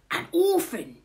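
high, loud exclamation, falling; astonished burglar. A narrator's reading. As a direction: cry it as a dawning realisation. Burglar reacts to finding baby.

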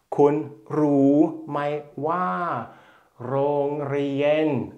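"Kun Ru Mai Wa, Rongrien" slow, every syllable on the even mid tone; teacher reads "Kun Ru Mai Wa, Rongrien" slowly in mid tone; mid tone Thai. Shown as a demonstration. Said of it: "alles im mittleren Ton".

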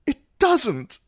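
high, thin frightened squeak. A narrator's reading. Sam squeaking back at the singer, frightened. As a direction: squeak it out over the thunder. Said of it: "he managed to squeak".

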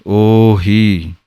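ਉਹੀ drawn out very slowly with both vowels long; long full oohi. Shown as a demonstration. Teacher to students, drawing out ਉਹੀ very slowly. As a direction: hold each vowel in ਉਹੀ fully. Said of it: "ਹਾਹੇ Bihari ਦੀ ਆਵਾਜ਼ ਵੀ ਪੂਰੀ ਆਉਣੀ ਹੈ".

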